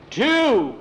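loud, high shouted number; threatening count. A narrator's reading. Tom counts before firing at the closet, threatening shout.

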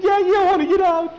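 very high, loud and fast; high rushed frightened plea; panicked plea. Acted. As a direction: gabble it in fear. Skeeter pleads to flee.

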